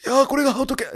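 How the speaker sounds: a voice that won't come out right, half-hearted mimicry; Hiraizumi Sei impression. Gamer at a shrine tries a famous actor's voice, failed mimicry. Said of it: "平泉聖のモノマネしようと思ったら全然出なかった".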